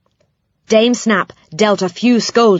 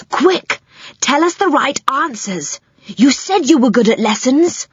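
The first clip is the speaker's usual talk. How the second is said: high, urgent man's plea; 7 semitones higher than usual. A narrator's reading. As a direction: press her for answers. Moonface urges.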